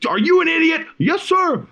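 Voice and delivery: high mock drill instructor yell; drill instructor shouting. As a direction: yell it in his face like a tiny drill instructor. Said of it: "he just starts shouting in his face".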